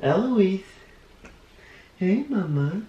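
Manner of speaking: cooing at their daughter. Real, not acted. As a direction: coo at the little one. Talking to baby, high voice.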